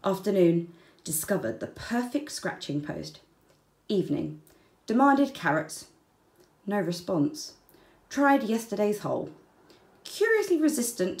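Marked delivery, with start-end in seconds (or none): 4.9-5.9 higher, stress on demanded; insistent demand. A narrator's reading. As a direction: push demanded up and firm. Wombat insisting on carrots.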